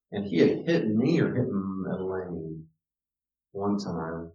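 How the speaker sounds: normal talking voice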